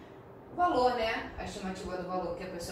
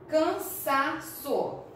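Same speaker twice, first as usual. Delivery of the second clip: "Cansaço" very slowly in three separate syllables, pitched up; 6 semitones higher, much slower than usual. Shown as a demonstration. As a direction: split "Cansaço" into syllables with clear pauses, stressing the middle one. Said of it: "Qual é a sílaba mais forte?".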